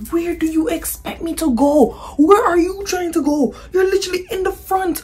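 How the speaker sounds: high-pitched indignant scolding, retold; exasperated rant. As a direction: pile up the accusations in a high voice. Acted rant at pushy girl.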